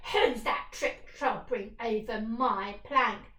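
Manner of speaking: a pirate chief mocking the troll; jeering. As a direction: sneer at the troll as he walks. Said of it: "jeered Hank Chief".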